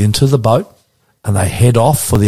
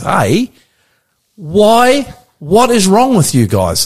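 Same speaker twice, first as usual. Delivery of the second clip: mildly exasperated, higher-pitched rebuke; upset; 6 semitones higher, much slower than usual. Retold or imitated. Host voices Jesus chiding his disciples, upset. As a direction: stretch it out in mock exasperation. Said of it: "almost like he gets upset with them".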